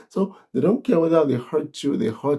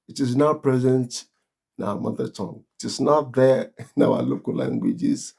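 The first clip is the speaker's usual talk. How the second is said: ordinary delivery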